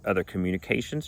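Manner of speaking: normal talking voice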